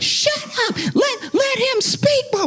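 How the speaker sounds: very high screeched mock shout; loud supporter impression. Lady drowning out other protesters, imitated high screaming. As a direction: screech over the crowd, hammed up. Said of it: "she's been the loudest against the other protest".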